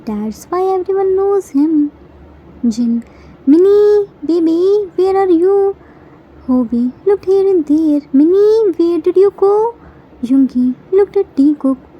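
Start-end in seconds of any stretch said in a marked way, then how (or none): 3.4-5.6 raised, higher calling voice, fast on the question. Acted. Brother calling for hidden Minnie, raised and high. 8.2-9.6 raised, higher calling voice. Acted. Hobi searching for hidden Minnie, calling out high.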